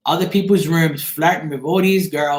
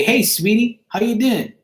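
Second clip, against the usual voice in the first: higher, flirty hello; sweet-talking greeting; 6 semitones higher than usual. Shown as a demonstration. Demonstrating how he greets people in the room, flirty. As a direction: charm them with a sugary greeting. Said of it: "I'll try it again right now".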